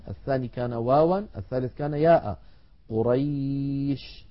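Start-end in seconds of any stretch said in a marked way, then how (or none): 2.9-4.3 قريش drawn out and stopped on the ش; recitation. Shown as a demonstration. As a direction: read قريش slowly. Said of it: "هذا مثال".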